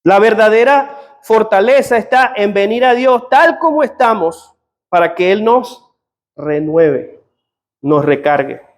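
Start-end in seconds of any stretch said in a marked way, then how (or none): none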